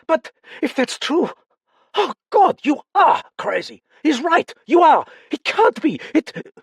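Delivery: captain's panicked outburst at the accusation; desperate outburst. Acted. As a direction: break into frantic hysteria. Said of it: "feared an outburst from Chaumont".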